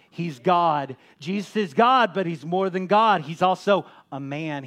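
loud, ringing preacher's cry; preaching at full force. Acted. Pastor preaching loudly to his congregation. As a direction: proclaim it to the whole church.

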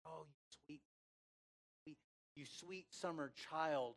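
soft, tender coo; gently indulgent, as to a naive child; sweet. Shown as a demonstration. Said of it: "is it like a sweet".

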